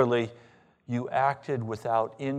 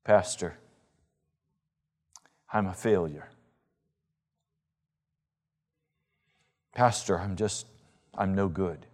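lower, mild imitation of a despairing congregant; despairing churchgoer; 4 semitones lower than usual. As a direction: play a downcast person confessing to the pastor. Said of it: "they say to me".

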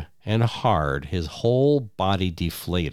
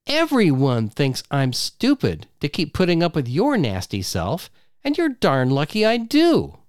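angry wife; 7 semitones higher than usual. Acted. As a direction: throw him out at the top of her voice. Wife rants at him.

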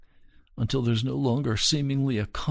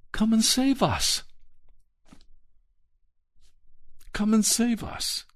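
high, desperate begging cry for rescue; pleading cry; 11 semitones higher than usual. Retold or imitated. Preacher voicing people begging God to save them, high and pleading. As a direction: plead like people in trouble crying out.

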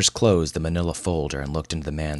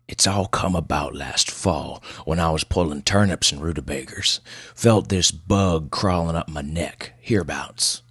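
put-on gruff old man. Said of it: "Pleasanton's voice was as gruff as his appearance".

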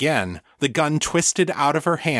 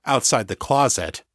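ordinary delivery, nothing marked

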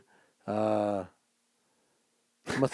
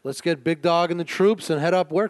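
usual speaking voice

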